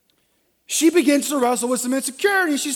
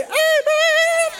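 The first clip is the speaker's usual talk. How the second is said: high, loud drawn-out cheer; congregational amen; 6 dB louder than usual. Acted. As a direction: belt it out high like delighted women. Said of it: "All the women say".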